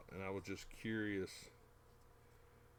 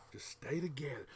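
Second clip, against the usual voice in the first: whispering; 3 semitones higher than usual. Real, not acted. Whispered plea to seam.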